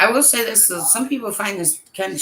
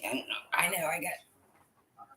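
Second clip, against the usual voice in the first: normal talking voice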